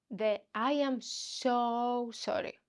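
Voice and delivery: slow, drawn-out "I am so sorry"; slow translation. Shown as a demonstration. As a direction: give "I am so sorry" slowly, word by word. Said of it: "translation of the Swedish phrase".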